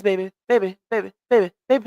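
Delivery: usual speaking voice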